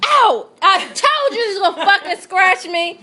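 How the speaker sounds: real ow after the cat scratches her hand; real pained yelp. Real, not acted. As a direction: cry out when scratched, then laugh-shout.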